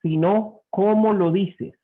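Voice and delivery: slow, with \"cómo\" hit hard; stress on cómo. Shown as a demonstration. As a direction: lean on the word \"cómo\" slowly. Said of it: "no es lo que dices, sino cómo lo dices". (énfasis lento en \"cómo\")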